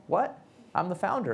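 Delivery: high, startled mock disbelief; mock shocked. Founder told he is now an investor, high startled disbelief. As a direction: play it as a jolt of disbelief.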